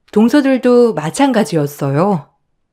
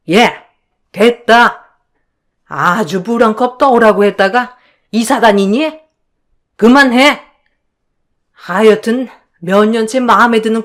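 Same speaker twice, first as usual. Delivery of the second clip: irritated, dismissive in-law voice; exasperated mother-in-law; 3 semitones higher, 3 dB louder than usual. Acted. Mother-in-law shuts down the quarrel, exasperated scolding. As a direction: cut the quarrel short.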